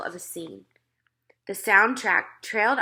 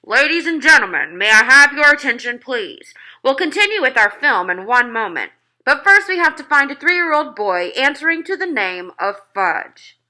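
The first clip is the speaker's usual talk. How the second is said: higher, much louder call to the hall; shouted announcement; 5 semitones higher, 10 dB louder than usual. A narrator's reading. Theater manager shouts to the audience through cupped hands. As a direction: project it to the whole theater. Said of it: "cupped his hands around his mouth and shouted".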